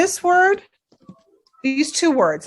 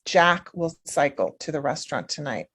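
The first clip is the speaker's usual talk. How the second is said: "Jack" stressed, said softer; 6 semitones lower, 5 dB quieter than usual. Shown as a demonstration. Teacher repeating the stressed sentence softly. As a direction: lean on Jack. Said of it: "Who's going to cycle? Jack".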